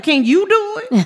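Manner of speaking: very high wheedling question; high-pitched, joking request; cheeky plea. Retold or imitated. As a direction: coax in a comically high voice. Retold cheeky plea, ending in laughter.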